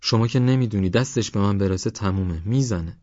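usual speaking voice

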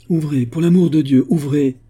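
high, urgent distinct plea; pleading woman. A narrator's reading. Woman at the window begs to be let in, urgent plea. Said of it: "parlant doucement mais d'une façon très distincte".

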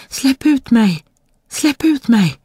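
high, insistent little cry; tiny creature calling. A narrator's reading. Frog-like creature shouts from inside the bottle, high calling. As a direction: call out high like a small trapped creature. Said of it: "hoppade upp och ner i flaskan och ropade".

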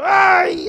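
high, loud "ay" wail; wailing cry. Retold or imitated. Showing how he began to weep, loud wail. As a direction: burst out in a re-enacted wail. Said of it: "Empecé a llorar".

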